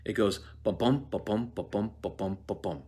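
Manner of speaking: ba-bum with the stress on bum each time; weak-strong ba-bum ba-bum pulse; iambic rhythm. Shown as a demonstration. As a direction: tap out the iambic beat. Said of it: "how do I say this".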